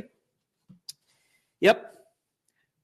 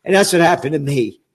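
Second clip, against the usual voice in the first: usual speaking voice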